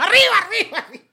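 very high, loud laughing cry; Mexican arriba. Pretend. Host showing how his accents always end, laughing arriba. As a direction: whoop it like a cartoon Mexican. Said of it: "yo empiezo el acento y termino con".

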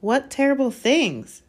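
high, loud, stretched demand; urgent question. A narrator's reading. Boy pressing the elf for details, urgent. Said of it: "said Harry at once".

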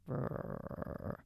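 voiceless rumbling growl; imitated ghost growl. Imitating the growl heard on the recording, rumbling. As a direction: mimic the growl from the boom mic. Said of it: "and then a growl".

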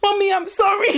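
high, whining apology; hammed-up whimper to mum; mock sorry child. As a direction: plead like a tearful little kid.

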